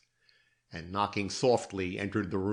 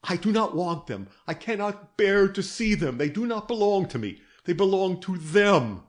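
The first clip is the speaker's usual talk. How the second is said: high, loud and frantic; pushing the rings away in loud anguish; terror and anguish; 9 semitones higher, 6 dB louder than usual. Acted. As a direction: plead in rising panic. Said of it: "her accents betrayed terror and anguish".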